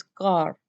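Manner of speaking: car with the final R sounded; R pronounced. Shown as a demonstration. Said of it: "we will pronounce R as well".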